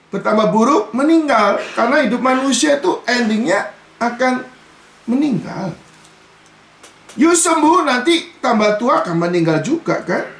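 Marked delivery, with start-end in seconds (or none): none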